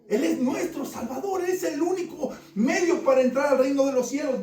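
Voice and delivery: full-force preaching. Acted.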